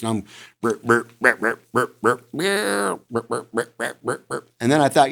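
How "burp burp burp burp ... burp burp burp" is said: quick run of short grunts; imitated buck grunts. Shown as a demonstration. Hunter imitating a buck pushing a doe, short repeated grunts. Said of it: "I'm doing this".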